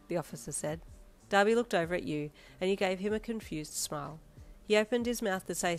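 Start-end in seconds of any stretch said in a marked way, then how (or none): none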